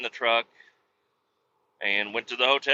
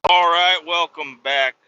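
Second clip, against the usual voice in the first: loud cheerful welcome back; loud greeting; 4 semitones higher, 7 dB louder than usual. Real, not acted. A trucker welcoming viewers back, loud and upbeat. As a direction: call it out to the viewers.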